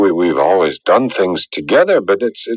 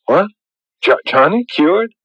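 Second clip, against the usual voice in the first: stunned disbelief; 8 semitones higher than usual. Acted. Stunned news of sudden cure.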